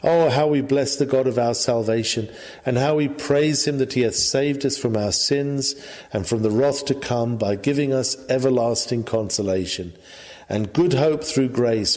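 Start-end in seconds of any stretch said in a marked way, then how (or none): none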